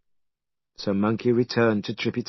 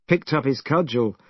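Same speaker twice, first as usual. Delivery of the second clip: ordinary delivery, nothing marked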